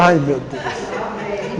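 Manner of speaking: very slow, drawn-out weary "Ai, meu Deus"; exasperated groan. Retold or imitated. Wife answering her husband's call, slow and exasperated. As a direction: drag it out, fed up. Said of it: "eu falo".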